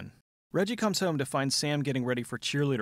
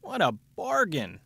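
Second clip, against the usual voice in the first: sarcastic; 8 semitones higher, 3 dB louder, slower than usual. Pretend. Mocking the offer.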